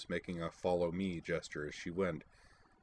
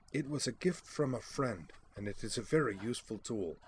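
higher, defensive Baroness voice; 5 semitones higher than usual. A narrator's reading. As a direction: justify yourself, a bit affronted. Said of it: "she said defensively".

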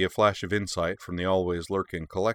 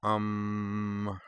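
softer, hesitant little um; mild hesitant interjection; 3 semitones higher, 4 dB quieter than usual. Acted. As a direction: test the waters before speaking. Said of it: "said Lindsay hesitantly".

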